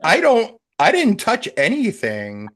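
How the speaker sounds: high, loud startled protest. Real, not acted. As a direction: blurt it out in surprise. Startled by glitch.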